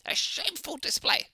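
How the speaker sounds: high, haughty scolding; mock stern commander. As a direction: sneer it like a disappointed officer. GM quotes stern judgement.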